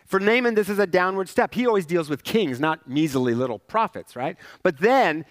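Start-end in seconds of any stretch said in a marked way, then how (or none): none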